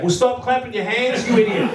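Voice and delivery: high-pitched mock yell in an Australian accent; Aussie heckler's yell. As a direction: heckle from the crowd, imitating an Aussie. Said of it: "Some Aussie yelled out".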